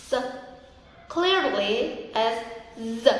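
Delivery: usual speaking voice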